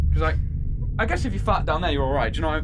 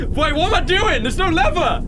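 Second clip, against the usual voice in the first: high-pitched flustered panic; panicked and much higher than usual; freaking out; 10 semitones higher, 4 dB louder than usual. Real, not acted. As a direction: blurt it out in rising alarm. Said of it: "I'm freaking out, man".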